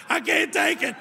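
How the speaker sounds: high, loud begging wail; boy faking pain on the principal's leg, wailing; mock pleading scream. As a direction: beg at the top of your lungs, hamming it up. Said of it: "started screaming".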